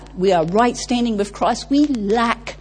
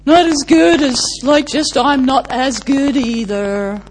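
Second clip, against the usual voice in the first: slow, mopey donkey voice, louder and higher; Eeyore voice; 3 semitones higher, 5 dB louder than usual. Retold or imitated. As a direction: sigh it out like a gloomy Eeyore. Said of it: "this is Eeyore's building a little structure".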